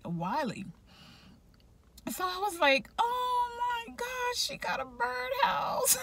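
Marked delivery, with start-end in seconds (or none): 3.0-6.0 high, crying wail, half laughing; playful fake crying over a birdhouse; mock wailing cry. Her own dismay at the news.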